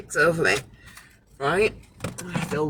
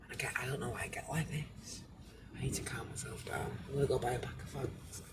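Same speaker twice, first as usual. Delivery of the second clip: near-silent murmur to oneself; real hushed self-talk, barely audible; self-soothing whisper; 5 semitones lower, 20 dB quieter than usual. Real, not acted. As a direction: whisper it privately, trying to calm down. Muttering to calm herself down.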